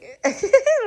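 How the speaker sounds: a burst of high laughter; loud high laugh. Real, not acted. Mother laughing at her sulking son, high laugh.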